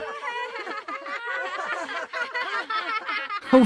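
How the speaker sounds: put-on high jeering children's laugh; mocking laughter. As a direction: laugh at the ugly boy. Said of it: "they laughed at him".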